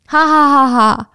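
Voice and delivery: loud ha ha ha laughter; mock laugh. [name] laughing at Wanda's message, a loud mock laugh. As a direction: belt out a smug text laugh.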